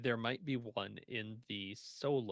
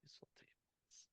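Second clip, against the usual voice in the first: whispered aside; 27 dB quieter than usual. Real, not acted. Aside while browsing books.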